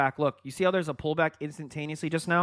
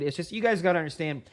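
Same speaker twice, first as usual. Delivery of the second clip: ordinary talk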